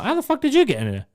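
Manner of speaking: half-laughing shock at the intruder; shocked disbelief. Retold or imitated. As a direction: gasp it out in disbelief. Shocked at dad appearing.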